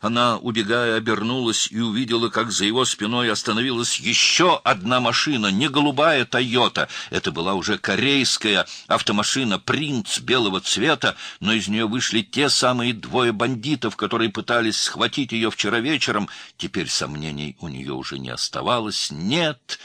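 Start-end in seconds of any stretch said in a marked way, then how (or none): 0.0-16.3 high, loud, urgent storytelling; tense action narration. A narrator's reading. Narrator telling of girl's flight, urgent. 19.1-19.8 higher, drawn cry of refusal; horrified cry. A narrator's reading. Girl seeing the bandits, crying in terror. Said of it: "закричала она, холодея от ужаса".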